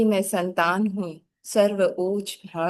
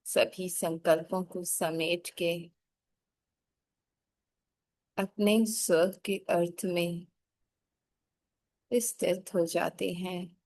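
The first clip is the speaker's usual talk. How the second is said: guided meditation; 5 dB quieter than usual. Acted. (धीमी, कोमल ध्यान करवाने वाली आवाज़)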